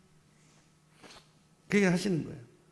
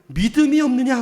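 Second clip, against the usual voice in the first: Jesus' rebuke; 6 semitones higher, 7 dB louder than usual. Retold or imitated. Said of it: "그다음 뭐라고요?".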